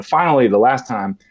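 normal talking voice

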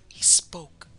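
hushed, whispered revelation; awed whisper. A narrator's reading. Terrified wife reveals the beast spoke, whispering. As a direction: whisper it in horror.

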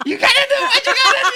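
high, loud laughing shout. Real, not acted. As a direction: yell the catchphrase. Shouts the catchphrase.

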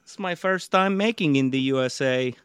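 put-on Slavic visitor accent. Shown as a demonstration. Comedian demonstrating a paid-for accent, Slavic accent. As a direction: play a newcomer with a thick Slavic accent. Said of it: "your Slavic accent you paid all this money for".

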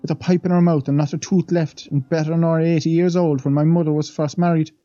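a lightly voiced old maid, awed; old Irish housemaid. The maid leans in to the young men, lightly voiced and awed. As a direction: tell it like a wide-eyed servant gossiping.

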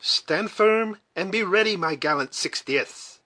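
commander calling to the troops; high rallying command, slowing at the end; rally the soldiers from above; sudden exclamation. A narrator's reading. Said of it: "suddenly exclaimed the voice above them".

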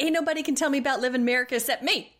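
fast, high, sassy mock outrage; quick, high-pitched defiance; indignant retort. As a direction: rattle it off as an offended diva. Acted indignant quote.

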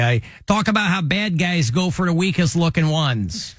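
broad Chicago cop impression; hard-selling safety talk in Chicago accent. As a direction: mimic a Chicago cop's lecture. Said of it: "that's the Chicago accent".

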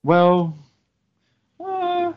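ordinary talk

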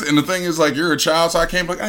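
usual speaking voice

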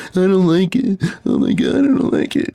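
put-on high, whining refusal in a little-girl voice; whiny toddler. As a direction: squeal it out like a kid spitting out food. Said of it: "She'll spit it out".

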